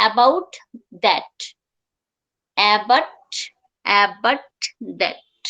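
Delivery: about that with both final Ts held unreleased. Shown as a demonstration. Teacher demonstrating the healthy T, held T. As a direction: stop the T in about, tongue stays up, then that. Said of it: "healthy যখন আমি যাব".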